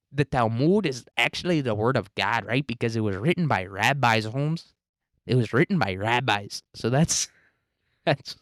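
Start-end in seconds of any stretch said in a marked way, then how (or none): 0.1-6.6 lilting mock Mexican accent, swooping up and down; caricatured sing-song Mexican accent. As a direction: do a hammed-up Mexican accent, lifting the last word. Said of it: "that's what Sheinbaum says to us".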